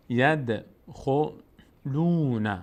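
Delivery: slow chant with echoed d in "ید"; bouncing d. Shown as a demonstration. Teacher showing the letter daal, d vibrating at its place. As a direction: let the d of "ید" bounce before a drawn-out "خلون". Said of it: "بلرزه".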